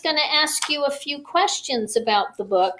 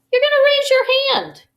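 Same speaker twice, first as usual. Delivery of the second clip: excited; 13 semitones higher, 5 dB louder, much faster than usual. Acted. Excited teacher to young kids.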